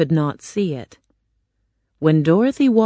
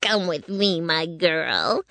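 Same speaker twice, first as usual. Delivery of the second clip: slow-burning witch's command, put-on voice; a witch luring her captive along; wicked witch; 5 dB quieter, slower than usual. A narrator's reading. Witch ordering Dorothy to follow her, in witch voice. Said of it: "the witch said to Dorothy".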